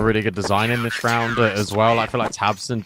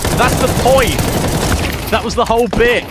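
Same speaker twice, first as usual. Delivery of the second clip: loud protest; 13 semitones higher, 7 dB louder than usual. Real, not acted. Protesting loudly, laughing.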